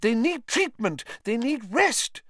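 high, indignant protest; spluttering outrage. Acted. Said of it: "spluttered Madame Pumphrey".

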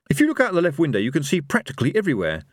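high, brisk character voice; Rincewind, translating. Acted. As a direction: hurry through it like a guide.

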